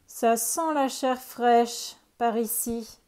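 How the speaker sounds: loud, slow ogre rumble; growling ogre. A narrator's reading. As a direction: growl it like a hungry giant. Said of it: "gronda l'ogre".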